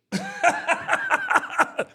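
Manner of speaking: real burst of high laughter; high-pitched giggle burst. Real, not acted. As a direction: squeal of laughter at the memory. Laughing at He-Man special.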